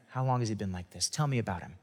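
soft, low and caring; soft tender question to a worried father; gentle bedside manner. Acted. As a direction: ask it like a kind doctor at a bedside. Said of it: "in great bedside manner".

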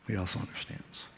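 He also understands, hushed and tender; soft echo. Shown as a demonstration. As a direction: say it again gently under your breath. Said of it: "echoed softly".

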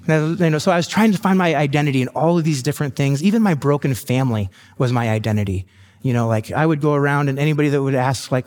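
ordinary talk, nothing marked

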